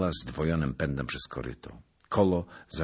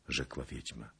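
ordinary delivery, nothing marked

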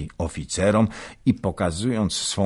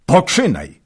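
high, much louder second shout; louder shouted urging; 11 semitones higher, 11 dB louder than usual. A narrator's reading. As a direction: push harder the second time. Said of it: "krzyknął Wolodyjowski".

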